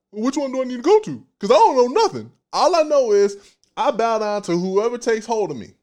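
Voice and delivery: high, quick, lost little voice; confused soul voice. Acted. Preacher voicing the soul, high and unsure. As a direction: play the soul who just bows to whoever wins.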